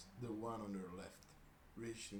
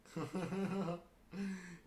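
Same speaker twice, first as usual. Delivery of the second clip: real laugh; 10 semitones higher, 6 dB louder than usual. Real, not acted. Reader laughs at the line.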